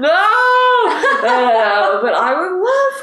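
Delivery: high, loud drawn-out protest; mock horrified cry. Male guides answering the revolution, mock horror. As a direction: wail it in pretend alarm.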